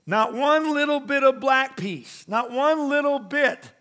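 emphatic preaching. Real, not acted. Preacher driving home the point.

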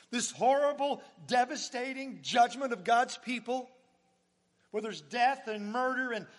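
rising sermon fervour; preaching build. Acted. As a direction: drive it home with force.

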